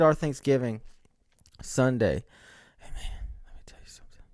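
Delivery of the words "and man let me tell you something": hushed, quick whisper; whispered aside. Real, not acted. Vlogger confiding in viewers before a story, whispered aside.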